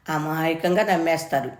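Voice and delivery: ordinary delivery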